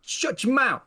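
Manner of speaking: high, loud outburst; young robber's jittery yell; nervous shout. Acted. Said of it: "psyching himself up for it".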